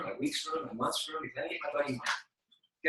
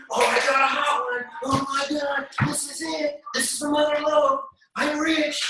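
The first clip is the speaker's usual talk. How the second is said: high, loud mock excitement; overexcited newbie; 11 semitones higher, 7 dB louder, slower than usual. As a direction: gush over a hot prospect. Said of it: "a lot of you will go".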